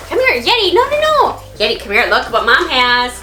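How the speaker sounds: calling the dog. Real, not acted. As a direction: coax the dog back, then call out sharply. Mom calling the runaway dog.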